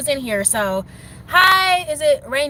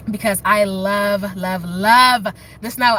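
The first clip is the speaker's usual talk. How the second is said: slow dreamy; 3 semitones lower, much slower than usual. Real, not acted. Dreamy love of snow.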